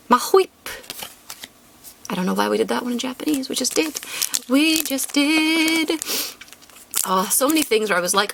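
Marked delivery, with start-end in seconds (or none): 3.5-4.0 breathy whispered aside, unvoiced. To viewers about the Japanese names, whispered. Repeating her own remark. 4.5-6.0 slow drawn-out. Pretend. To viewers about the Japanese names, slow and drawn out. Repeating her own remark.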